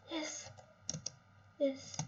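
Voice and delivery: ordinary talk, nothing marked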